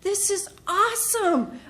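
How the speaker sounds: rising to a high awesome; excited. Retold or imitated. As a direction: gush over the materials. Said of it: "I was so excited".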